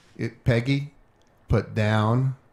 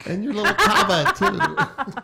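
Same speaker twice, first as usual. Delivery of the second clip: high squeaky witch imitation; 12 semitones higher, slower than usual. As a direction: screech it like the Wicked Witch. Mocking the witch line.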